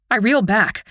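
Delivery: ordinary delivery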